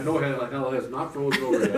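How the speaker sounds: ordinary talk, nothing marked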